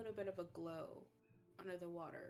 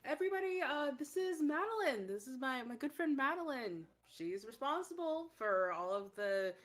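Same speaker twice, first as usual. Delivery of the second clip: loud, higher-pitched introduction to a crowd; effusive host; 6 semitones higher, 9 dB louder than usual. Acted. [name] introducing Madeline to everybody, effusive. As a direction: announce a friend loudly and proudly.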